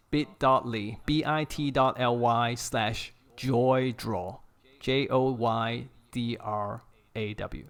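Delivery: slow, careful repeat of a web address; slow dictation for people copying it down; dictating a link. Real, not acted. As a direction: spell it out letter by letter, unhurried. Repeating the link slowly for listeners.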